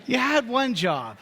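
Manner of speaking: high, hammed-up punchline; mock exasperation. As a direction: throw the line up high like a comic groan. Repeating the meme punchline.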